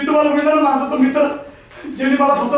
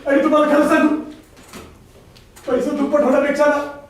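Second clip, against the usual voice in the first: ordinary talk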